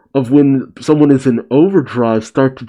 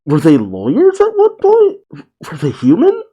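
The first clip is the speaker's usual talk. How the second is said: real astonishment, voice shooting high; baffled surprise; 7 semitones higher than usual. Real, not acted. Reader reacting to the manga twist, stunned and high. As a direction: pitch jumps way up in disbelief.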